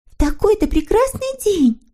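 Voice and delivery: put-on very high, sweet girlish character voice; eager little girl figure. A narrator's reading. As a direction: agree gladly in a high little voice. Snork Maiden consoles Hemulen. (тоненький девичий голосок)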